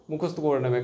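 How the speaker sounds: ordinary delivery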